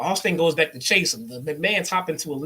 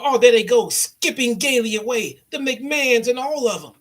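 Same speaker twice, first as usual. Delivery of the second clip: higher, louder sarcastic jab; sarcastic mock J R; 6 semitones higher, 6 dB louder than usual. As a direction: sneer it like a bitter commentator. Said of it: "He's like".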